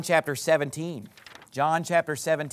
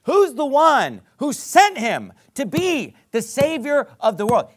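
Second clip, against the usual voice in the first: high, loud rhetorical questions; forceful pulpit questioning; rousing preaching; 7 semitones higher, 5 dB louder than usual. Real, not acted. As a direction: press the congregation with a raised voice.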